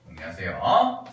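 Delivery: normal talking voice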